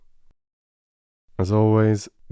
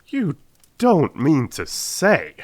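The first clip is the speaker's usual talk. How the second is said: higher, slower astonished question; a touch of disbelief; amazed cry; 8 semitones higher, 4 dB louder, slower than usual. Acted. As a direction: Watson amazed, drawn out. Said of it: "I cried in amazement".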